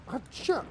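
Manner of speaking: half-voice mock warrior yelp; high battle cry. Cry on throwing the harpoon.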